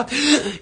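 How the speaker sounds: quick loud panicked gasp for air; sharp gasp. Acted. Host acting out a sleeper losing breath, sharp gasp.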